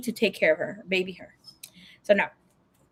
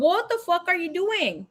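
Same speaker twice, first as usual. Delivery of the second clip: retold telling-off, pitched up in disbelief; exasperated scolding; 7 semitones higher than usual. Scolding her friend for getting out of the car, exasperated.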